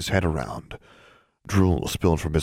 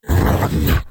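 loud snarled command; 7 dB louder than usual. A narrator's reading. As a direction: snarl it like a beast. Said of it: "he snarled".

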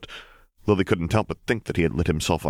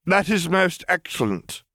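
lordly approval, drawn out; slow, high grand drug lord; 13 semitones higher, slower than usual. Acted. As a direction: savour each word, much higher.

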